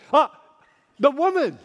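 WOMAN hit high and loud, pointing the finger; emphatic blame. Retold or imitated. Adam blaming God, emphatic on woman. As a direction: throw the blame onto the woman. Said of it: "It's very emphatic".

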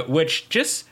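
normal talking voice

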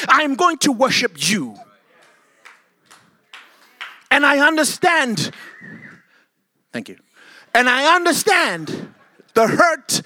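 loud, high, rising sermon cry; preaching at full force. Acted. Preacher to congregation, preaching at full force.

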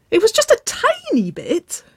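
very high, loud giggly plea; squeaky protest. A narrator's reading. Waverley wriggling out of Nicole's grasp, squeaking in protest. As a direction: squeak it out defensively. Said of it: "she squeaked, giggling as she tried to step back".

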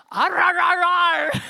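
high, loud growling arr arr; roar. Retold or imitated. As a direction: roar out a fake French growl. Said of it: "hører jeg et brøl". (høyt brølende arr arr)

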